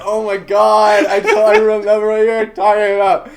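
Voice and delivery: high loud excited yelling; excited shout. Real, not acted. Excited recognition.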